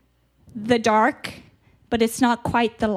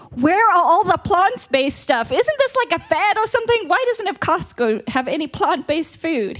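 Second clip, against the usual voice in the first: mock annoyed friend; 7 semitones higher than usual. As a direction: play the baffled friend griping in the store. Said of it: "He was, he was kinda mad at Costco".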